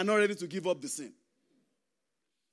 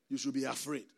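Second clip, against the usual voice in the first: normal talking voice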